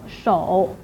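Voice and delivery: 手 in a low dipping third tone. Shown as a demonstration. Teacher names the following syllable, low third tone. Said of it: "followed by a third tone".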